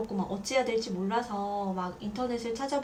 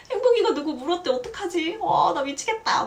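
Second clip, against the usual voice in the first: much higher, louder, fast panicked wail; frantic panic; 8 semitones higher, 8 dB louder than usual. Retold or imitated. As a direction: spill it out fast like you're losing it. Panicking to her mother.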